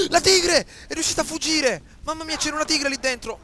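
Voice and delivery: sudden high loud exclamation; real shock as the tiger bursts out; startled cry. Real, not acted. Gamer startled as the tiger escapes, surprised cry.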